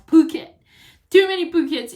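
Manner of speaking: mock the endless repetition; loud mock-exasperated exclaim. Shown as a demonstration. Mocking overused word.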